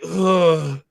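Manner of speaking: long wordless "ugh"; re-enacted morning-after groaning; imitated hungover groan. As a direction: groan like a bad hangover. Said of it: "we were just making noises".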